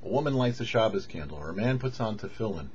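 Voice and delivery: ordinary talk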